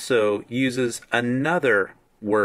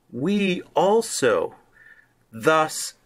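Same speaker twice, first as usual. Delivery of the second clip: we also raised high and slow, thus lifted; slow emphatic also; stress on we also; 4 semitones higher, much slower than usual. Shown as a demonstration. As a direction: pitch we also high and draw it out. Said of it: "and a stress".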